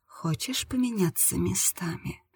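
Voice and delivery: hushed, near-whispered purr. A narrator's reading. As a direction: purr the offer very softly. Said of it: "промурлыкала она".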